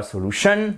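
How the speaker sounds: "solution" avec la dernière syllabe qui monte; accent tonique fautif. Shown as a demonstration. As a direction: appuie à tort sur la fin de "solution". ("solution" mal accentué, so-lu-TION)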